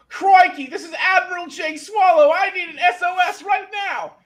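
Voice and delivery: put-on loud, high Australian accent shouting for help; a bad, hammed-up Australian accent calling SOS. Admiral radioing an SOS, loud hammed-up Australian accent. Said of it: "it's the Australian version of me".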